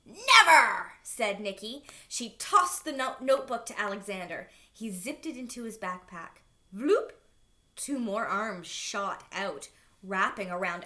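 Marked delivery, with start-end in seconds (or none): 0.0-0.9 loud, high refusal; defiant shout. A narrator's reading. Nikki refusing the monster, defiant shout. 6.7-7.1 loud rising vloop; stretchy sound effect. A narrator's reading. Blob shooting out arms, rising sound effect.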